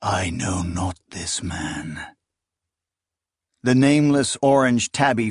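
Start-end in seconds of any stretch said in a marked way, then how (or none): 0.0-2.0 lower, softer and slow; low, slow denial. Acted. Akamian echoing the denial in despair, low slow and quiet. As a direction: let it sink, heavy and quiet.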